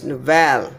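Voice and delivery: novel as no-VEL, stretched ending; stress on second syllable. Shown as a demonstration. Teacher showing what goes wrong, novel as no-VEL. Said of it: "If I say the second one is".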